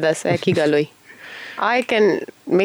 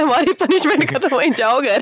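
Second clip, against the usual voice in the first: mock teacher; 6 semitones higher, much faster than usual. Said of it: "तूने कह रहा है".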